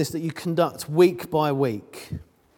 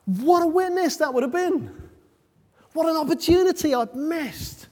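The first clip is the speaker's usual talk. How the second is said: lifted much higher, ruefully; rueful exclamation; 11 semitones higher than usual. Real, not acted. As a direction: cry out the missed chance up high. Preacher regrets a missed chance.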